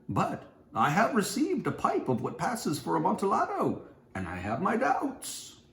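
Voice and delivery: a hint of a scheming host; Montresor greeting. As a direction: gush over him with false warmth. Narrator voices Montresor greeting.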